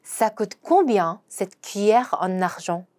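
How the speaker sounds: very slow, careful French; slow model sentence again; slow repeat. Shown as a demonstration. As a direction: drag out "combien" clearly. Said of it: "한 번 더".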